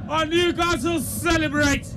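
high-pitched, elated summing-up; still-thrilled football commentator; excited post-goal commentary. Real, not acted. Commentator sums up after the goal.

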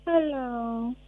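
drawn-out, wistful hello; long, drooping sad greeting. Shown as a demonstration. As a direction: say it like you're down. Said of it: "say hello in a sad mood".

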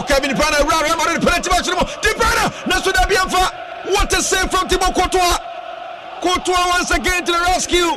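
high, shouted live call; excited commentary. Acted. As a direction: call the big save at full pitch. Live call of De Bruyne chance.

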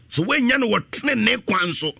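high-pitched, insistent preaching; impassioned preaching. Real, not acted. Preacher warns congregation about getting wealth unrighteously, preaching hard. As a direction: press the warning home from the pulpit.

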